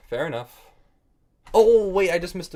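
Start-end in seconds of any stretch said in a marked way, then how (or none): 1.5-2.5 a loud, high "oh, wait" as the mistake dawns; sudden alarm. Real, not acted. Streamer catching his own blunder, loud sudden alarm. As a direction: gasp it out, suddenly seeing the miss. Sudden realization of missed move.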